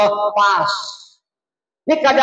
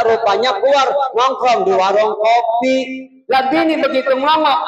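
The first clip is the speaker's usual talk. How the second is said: normal talking voice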